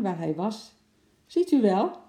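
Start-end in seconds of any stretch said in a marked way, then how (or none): 1.3-2.0 high, loud complaint from an upset customer; indignant call. Acted. Woman with a stuck bike to the attendant, indignant call. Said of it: "riep ze".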